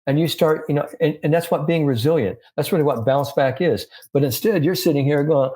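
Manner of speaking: normal talking voice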